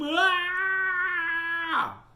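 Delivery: long, very high loud scream; frightened yell. A narrator's reading. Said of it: "And his father yelled".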